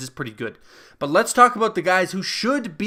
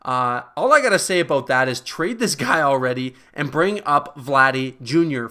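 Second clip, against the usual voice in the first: ordinary talk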